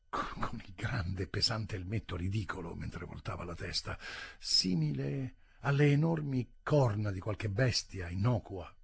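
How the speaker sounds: ordinary delivery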